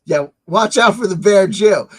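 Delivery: ordinary delivery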